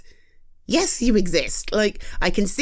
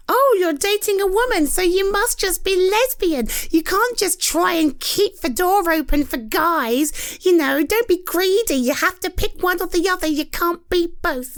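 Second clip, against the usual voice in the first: higher, needling mimicry of the critics; mocking critics; 10 semitones higher than usual. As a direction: pitch it up and parrot the nagging. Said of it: "Do you remember the comments then?".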